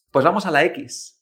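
usual speaking voice

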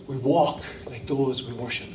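very low, soft and deliberate; quiet, solemn preacher's key line; hushed refrain. Real, not acted.

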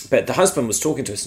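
usual speaking voice

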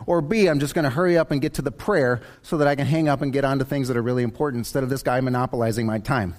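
ordinary talk

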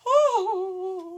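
high, loud, drawn-out ooh; hammed-up scandalized reaction; mock shocked gasp. Everyone staring at the student, a high mock-scandalized ooh. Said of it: "looked at me like".

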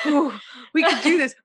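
a guilty little giggle of relief; relieved giggle. Retold or imitated. Relieved about cancelled guest.

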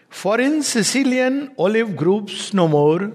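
measured reading, stretching the words; poem recitation. Acted. Reading the poet's farewell lines to listeners, recitation.

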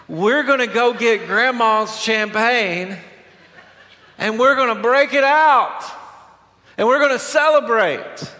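hammed-up, high-pitched party enthusiasm; excited party host.

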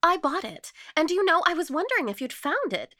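high, loud, eager burst; excited cry. A narrator's reading. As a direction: gush it out, pitched well up. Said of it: "cried Martha Pym".

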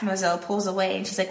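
usual speaking voice

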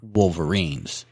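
slow, drawn-out wolverines; stress on wolverines. Real, not acted. Host correcting wolves to wolverines, slow and stressed.

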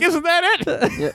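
high, slow, laughing mock innocence; mock innocent. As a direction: play dumb with a giggle. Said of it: "And you're like".

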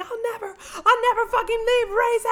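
high, loud hammed-up refusal; mock-hysterical cry of devotion; Feyre. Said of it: "And Feyre's like".

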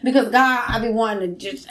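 ordinary talk, nothing marked

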